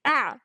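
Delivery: sharp surprised yelp; startled yelp. A narrator's reading. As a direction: yelp as pulled down. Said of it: "was cut off".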